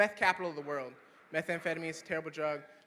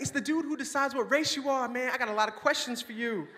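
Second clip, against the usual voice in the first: excited mock demand; 6 semitones higher, 3 dB louder than usual.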